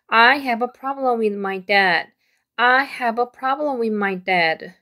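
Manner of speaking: slow and carefully clear; slow model reading. Shown as a demonstration. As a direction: read it out slowly for learners. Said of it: "쭉 내려가면서 읽어보도록 할게요".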